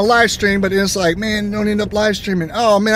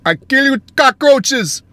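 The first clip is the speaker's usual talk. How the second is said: horrible accent impression; 7 semitones higher, slower than usual. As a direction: ham up a bad movie villain threat. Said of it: "my horrible impression".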